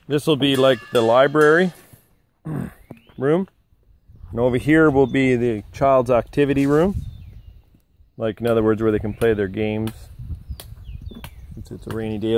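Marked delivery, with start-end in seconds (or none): none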